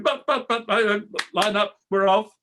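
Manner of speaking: put-on high panicked stammer of a boy; flustered boy. Boy in his underpants protesting, high and flustered. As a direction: sputter like a caught-out kid.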